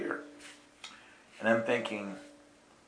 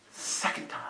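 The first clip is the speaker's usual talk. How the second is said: hushed; 8 dB quieter than usual. Retold or imitated. Girl's hushed reply.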